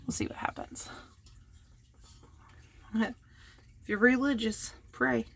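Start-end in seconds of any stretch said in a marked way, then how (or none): 0.1-0.9 nervous breathy whisper. Real, not acted. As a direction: whisper it to yourself.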